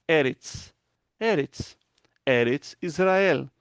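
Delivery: Eretz with a crisp final ts, then Eretz Israel slowly; Hebrew eretz. Shown as a demonstration. Teacher modelling the Hebrew word for land, crisp final ts. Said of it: "como é que é terra em hebraico".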